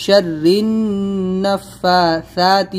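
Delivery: slow, النَّفَّاثَاتِ with a held nasal ن; correct again. Shown as a demonstration. Teacher repeats the correct reading. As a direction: hold the ghunna on النَّفَّاثَاتِ. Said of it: "غنہ بھی کریں گے".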